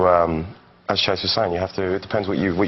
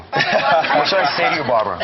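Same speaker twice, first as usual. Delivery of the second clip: higher-pitched American accent on request; 6 semitones higher, 3 dB louder than usual. Shown as a demonstration. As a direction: put on an American accent, playing along. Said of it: "Talk American".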